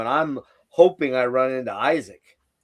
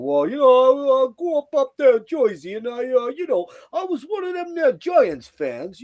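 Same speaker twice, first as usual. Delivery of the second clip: Rocky voice; 12 semitones higher, 5 dB louder than usual. Retold or imitated. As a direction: mimic a friend's put-on voice, pitched way up. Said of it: "I put on my Rocky voice".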